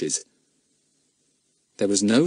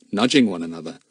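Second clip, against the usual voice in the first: ordinary delivery, nothing marked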